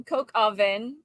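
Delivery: American "coq au vin", vin with sounded N; most American. Shown as a demonstration. Guest demonstrates French dish, most American pronunciation. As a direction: read "coq au vin" as coke-oh-vin. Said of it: "With the most American".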